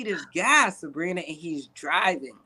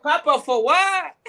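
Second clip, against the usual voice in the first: put-on high, slow Spanish-accented question; imitated Spanish accent; 8 semitones higher, 3 dB louder than usual. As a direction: play a baffled man with a Spanish accent. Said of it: "I just use the accent".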